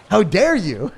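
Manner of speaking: high, loud mock indignation; mock outrage. Streamer jokes he is being mocked, mock outrage. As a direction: play up being scandalized, loudly. Jokingly affronted at supposed mocking.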